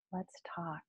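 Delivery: breathy whispered invitation; softly whispered let's talk. Acted. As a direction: whisper it, intimate and close.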